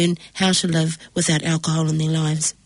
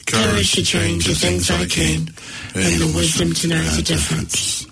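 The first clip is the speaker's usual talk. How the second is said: recited prayer. Real, not acted. Group recites Serenity Prayer.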